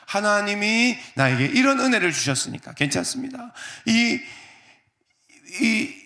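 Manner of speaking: fervent comfort. Acted. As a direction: lift the voice with grateful emotion. Joseph comforting his brothers. (감격에 찬 높은 목소리로 형들을 위로하며)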